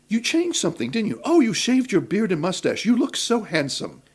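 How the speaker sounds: high, cooing compliment; fawning compliment. Retold or imitated. Two actresses fawning over his shave, high and cooing.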